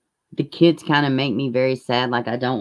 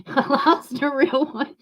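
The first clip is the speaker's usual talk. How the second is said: laughing; 10 semitones higher, slower than usual. Real, not acted. Repeats own quote laughing.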